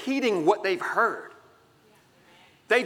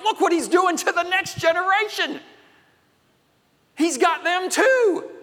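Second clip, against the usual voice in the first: alarmed leaders; 9 semitones higher, 3 dB louder than usual. Acted. Leaders' alarmed complaint voiced.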